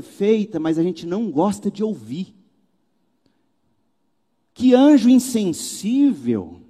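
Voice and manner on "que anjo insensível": slow, drawn-out mock outrage at the angel; mock indignant. Mocking the churchgoers who call the angel insensitive. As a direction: put on the offended churchgoer.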